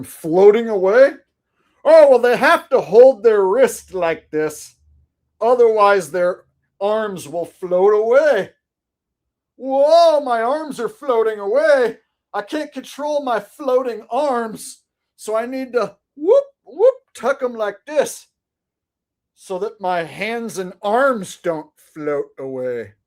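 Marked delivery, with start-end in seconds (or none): 1.8-8.5 sarcastic, higher and louder, mocking the excuse; mock explanation. 9.6-22.9 hammed-up panicked astronaut, higher, slow; mock astronaut. Acting out floating arms.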